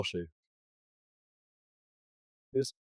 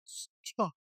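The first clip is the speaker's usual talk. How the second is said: mock stammering teacher; 7 semitones higher, 5 dB quieter than usual.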